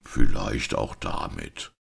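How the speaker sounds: innocently conceding; understated naive reply; simple-minded. A narrator's reading. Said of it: "gab Braun einfältig zur Antwort".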